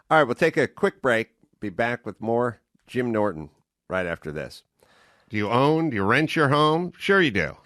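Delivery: upbeat radio ad read. Acted. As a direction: read it like a sponsor spot. Host reads sponsor ads.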